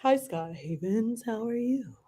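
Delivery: mock formal greeting. Shown as a demonstration. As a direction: greet him stiffly with his full name, slowly. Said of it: "Did you notice I didn't say your full name?".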